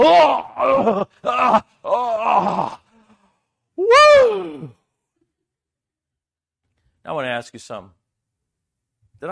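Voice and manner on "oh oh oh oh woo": much higher, much louder shouts of relief; a cramped dad popping out of the tent and whooping with relief; relieved whoop. Real, not acted. Relief escaping the tiny tent.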